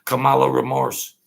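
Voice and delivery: ordinary talk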